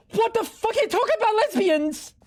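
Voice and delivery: fast, high-pitched disbelief; incredulous outburst. Real, not acted. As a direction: pitch shoots up in utter bafflement. Baffled outburst at the repeated remark.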